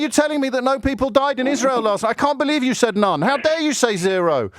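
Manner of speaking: high-pitched, put-on indignation; mock outrage. Host throwing caller's complaint back at him, mock outrage. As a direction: feign being scandalised.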